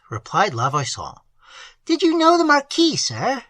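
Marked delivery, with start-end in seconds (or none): 1.8-3.4 put-on higher, earnest voice for the old host; higher voice of a plain-spoken country host; old peasant host. A narrator's reading. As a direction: voice the simple host, eager to tell. Host asks about the Marquis.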